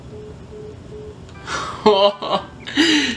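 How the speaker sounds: breaking into high laughter; cracking up after the hang-up; high burst of laughter. Real, not acted. Receptionist just hung up.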